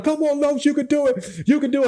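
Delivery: high-pitched cheering, imitating classmates; mock yelling cheer. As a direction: call it out high like encouraging a struggling swimmer. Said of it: "they was all still yelling".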